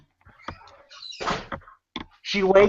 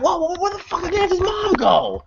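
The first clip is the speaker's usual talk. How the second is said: high, startled disbelief; baffled shock; 12 semitones higher than usual. Retold or imitated. Viewer reacting to the mom vanishing, baffled shock. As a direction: react like you just saw a ghost vanish. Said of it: "And I'm like".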